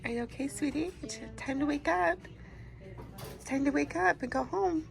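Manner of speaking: soft, soothing wake-up voice; gentle coaxing. Real, not acted. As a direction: coax him awake tenderly. Waking husband after anesthesia.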